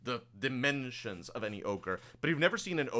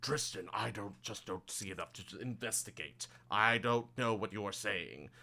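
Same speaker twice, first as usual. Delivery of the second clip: grumbling ogre. Acted. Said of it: "the ogre just kind of going".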